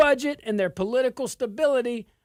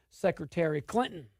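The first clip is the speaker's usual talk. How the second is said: usual speaking voice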